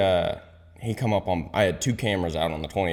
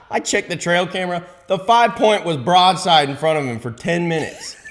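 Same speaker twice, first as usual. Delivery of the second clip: high, loud indignant retelling; heated rant; 10 semitones higher, 6 dB louder than usual. Real, not acted. Ranting to his friend about the buddy, high and loud. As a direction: rail against him.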